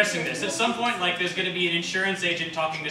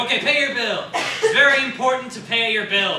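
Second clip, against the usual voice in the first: louder, higher, laughing mock announcement; mock translator; 6 semitones higher, 6 dB louder than usual. [name] faking a translation of the bill slide, laughing loudly. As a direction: play the made-up translator, laughing. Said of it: "he's like".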